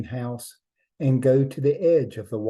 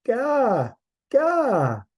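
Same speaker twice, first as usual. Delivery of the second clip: put-on high babbling baby cry; baby Raven voice; 12 semitones higher, 4 dB louder than usual. A narrator's reading. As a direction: squeal it like a demanding baby. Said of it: "Raven Child said".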